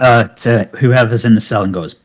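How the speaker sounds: ordinary delivery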